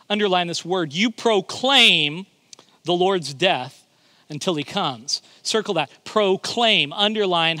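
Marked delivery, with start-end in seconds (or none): 0.9-5.2 slow scripture reading. Acted. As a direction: draw each word out.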